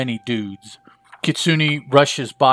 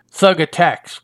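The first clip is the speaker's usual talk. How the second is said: plain reading voice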